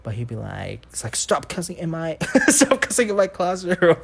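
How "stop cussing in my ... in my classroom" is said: mock teacher telling off, higher and louder by the end; building into a hammed-up teacher scold. Teacher scolding the cussing student.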